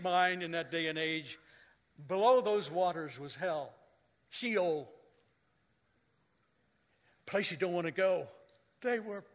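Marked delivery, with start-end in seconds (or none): none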